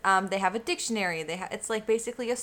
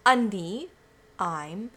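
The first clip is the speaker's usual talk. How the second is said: normal talking voice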